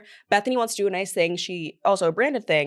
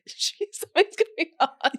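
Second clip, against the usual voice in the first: laughing impression; 6 semitones higher than usual. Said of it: "Sonia being like".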